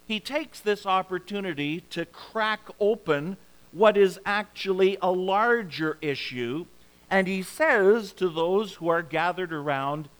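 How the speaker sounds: normal talking voice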